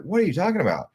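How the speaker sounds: high, puzzled what-is-this question; high baffled protest at the yelling driver. Retold or imitated. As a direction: react in confused disbelief. Confused by yelling taxi driver.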